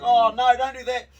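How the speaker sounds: loud, high mock alarm; alarmed cry. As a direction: cry out as someone sinks his teeth into an ear. Biting thief, bus scene.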